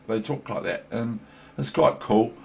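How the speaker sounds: normal talking voice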